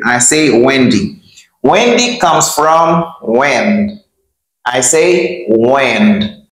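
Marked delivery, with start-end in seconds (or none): 5.5-6.5 careful teacher model; slow model word. Shown as a demonstration. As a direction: say "wind" slowly. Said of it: "Let's pronounce this with me".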